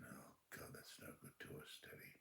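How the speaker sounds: near-whispered worry; very quiet, troubled reply; hushed dismay. Retold or imitated. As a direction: breathe it out, barely audible. Detective's dismay, retold.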